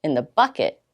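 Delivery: ordinary delivery, nothing marked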